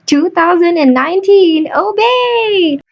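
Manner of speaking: announcer build, pitch climbing to a high, held name; hyped intro rising high into the name; grand introduction. Pretend. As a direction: present the guest like a ring announcer.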